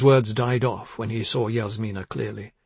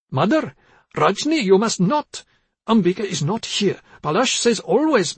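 high, louder protest from a muddled mother; agitated confused mother; 9 semitones higher, 5 dB louder than usual. A narrator's reading.

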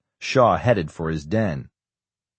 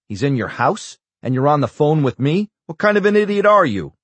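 louder and much higher, rising rage; furious; 8 semitones higher, 6 dB louder than usual. Acted. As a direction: blow up at his careless ally. Senator furious at Shaw.

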